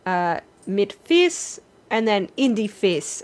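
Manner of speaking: fis with s, Northern Yiddish; Litvish Yiddish. Shown as a demonstration. Teacher showing the Northern dialect, said slowly. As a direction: say fis, not fish, Litvish style. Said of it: "you would say".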